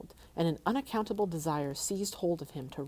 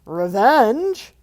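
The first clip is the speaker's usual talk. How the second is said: loud scornful dragon snort; 6 semitones higher, 15 dB louder than usual. Acted. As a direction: spit the word out like a dragon. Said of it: "he snorted".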